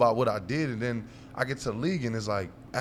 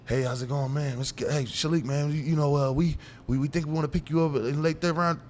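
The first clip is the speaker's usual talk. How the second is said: higher-pitched imitation of a phone caller; team caller; 6 semitones higher than usual. Team calling a player on draft day, imitated caller voice. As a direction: put on a higher voice for the team's recruiter. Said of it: "I get a call".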